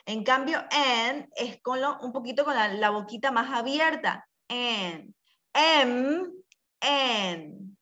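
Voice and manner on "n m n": N open-mouthed, M heavier and humming; contrasting N and M. Shown as a demonstration. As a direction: show the difference between N and M. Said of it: "Esa es la diferencia".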